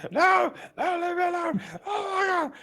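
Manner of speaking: high-pitched, loud panicked shrieking; horrified shriek. Acted. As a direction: shriek in hammed-up terror. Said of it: "shrieks in horror".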